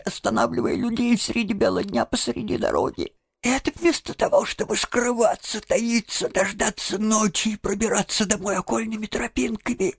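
high, fast self-reproach; despairing lament. A narrator's reading. Toad scolds himself for showing off, high despairing lament. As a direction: wail at your own foolishness in a high, rushing voice. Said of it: "в отчаянии".

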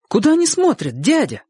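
put-on high-pitched child's voice; boy's question. A narrator's reading. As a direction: ask your uncle as a curious boy. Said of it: "спросил Чик". (высокий детский голос)

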